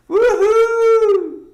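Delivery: high, loud, long whoop that falls away; excited whoop. Real, not acted. Streamer cheering a viewer's comment with a loud whoop. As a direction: whoop with joy for her.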